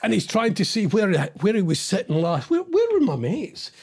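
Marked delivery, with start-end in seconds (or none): 2.5-3.7 put-on high, lost pub drunk; confused drunk. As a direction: look around for your mates, lost. Drunk man quote.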